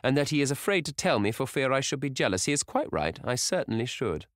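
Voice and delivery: put-on high, playful old hostess; teasing cry. A narrator's reading. Hostess teasing Dorian before the table, high and playful. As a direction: cry it out teasingly, pitched well up. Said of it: "cried Lady Narborough".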